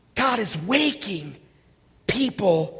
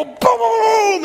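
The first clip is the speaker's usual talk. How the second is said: loud, higher booms; imitated explosions; 11 semitones higher, 6 dB louder, slower than usual. Preacher mimicking the flash pots, loud booms. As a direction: punch out each boom.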